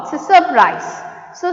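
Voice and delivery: usual speaking voice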